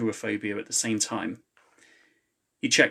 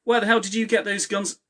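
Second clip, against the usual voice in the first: high outraged cry, much higher; excitable woman's outraged shout; 10 semitones higher, 4 dB louder than usual. A narrator's reading. Woman shouting at the clones, high and outraged. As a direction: demand it, shocked and angry.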